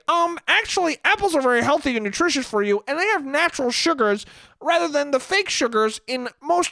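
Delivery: high, quick, smug nerd voice; prissy know-it-all kid. Acted. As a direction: sound like a little know-it-all correcting grown-ups. Mocking nerdy kid at Halloween.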